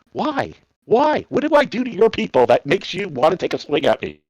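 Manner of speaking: high-pitched, incredulous pleading; retold exasperated protest. American retelling his plea to men who swung at him, exasperated.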